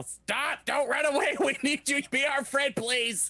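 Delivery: very high goblin plea at normal volume; pleading mock yell. Goblin begging the bolting horse to stay, high pleading mock yell. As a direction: beg in a squeaky put-on high voice. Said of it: "cast Speak with Animal and yell".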